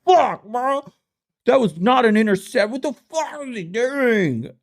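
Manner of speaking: high, hammed-up angry fan; mock bar fan yelling. Bar fans yelling at the game, high mock rage. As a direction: yell at the TV like a drunk fan. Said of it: "just screaming".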